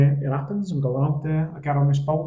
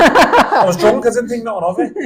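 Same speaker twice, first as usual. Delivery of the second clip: laughing; 6 semitones higher, 10 dB louder than usual. Real, not acted. Laughing at own drunken boast.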